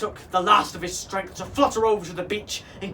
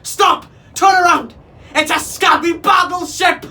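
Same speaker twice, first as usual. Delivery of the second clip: very high, loud parrot screams; desperate parrot shouting; screamed warning; 11 semitones higher, 14 dB louder than usual. A narrator's reading. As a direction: shriek frantic warnings at the crew. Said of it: "screamed Neptune".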